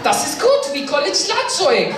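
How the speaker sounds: mock German accent. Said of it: "they were just like".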